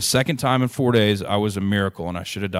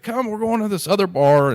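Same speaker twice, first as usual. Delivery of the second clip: high, cheerful invitation; newlywed old lady; 11 semitones higher, 4 dB louder than usual. Retold or imitated. Old lady inviting the couple to another bar, high cheerful call. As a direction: wave them along, voice pitched well up. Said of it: "It's like".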